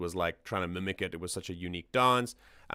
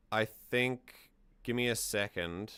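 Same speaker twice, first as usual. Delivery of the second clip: usual speaking voice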